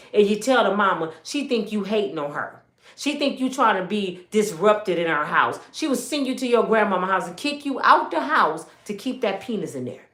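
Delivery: ordinary delivery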